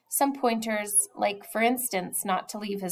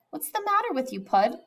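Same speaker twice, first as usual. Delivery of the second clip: mocking kid; 7 semitones higher than usual. Kid mocking new kid in class.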